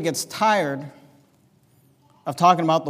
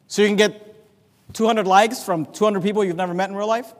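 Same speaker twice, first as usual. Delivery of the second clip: ordinary delivery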